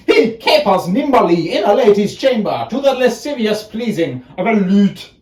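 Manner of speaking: high, loud, quick theatrical mockery; mocking. Mocks the courtly lover.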